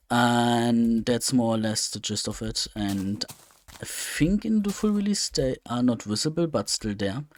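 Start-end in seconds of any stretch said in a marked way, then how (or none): none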